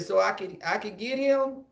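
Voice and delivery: put-on high, hopeful little-boy question; eager kid. Young boy asking his aunt for permission to hit back. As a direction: ask with glee. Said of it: "And I said".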